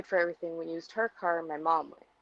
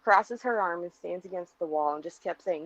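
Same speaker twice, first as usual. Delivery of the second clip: normal talking voice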